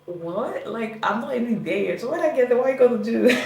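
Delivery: climbing high and loud in disbelief; half-laughing, rising indignation; loud mock-outraged what-are-you-gonna-do; incredulous outburst. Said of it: "I'm just like".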